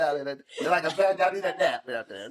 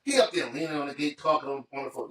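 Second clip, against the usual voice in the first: ordinary talk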